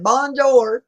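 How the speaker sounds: loud, high Southern-accented bonjour. Shown as a demonstration. Streamer unable to do a French accent, greeting in a Southern accent. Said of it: "with a Southern accent".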